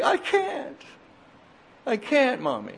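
put-on very high, drawn-out child's whine; whining little boy. Crying boy answers his mommy, high plaintive whine. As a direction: whimper up high like a small child.

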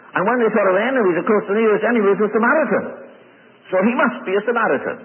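high, building sermon delivery; forceful preaching. Acted. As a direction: drive the argument home from the pulpit, rising in pitch. Preaches opponents' reasoning at full force.

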